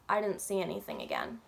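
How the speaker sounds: usual speaking voice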